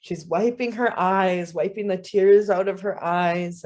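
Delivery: plain reading voice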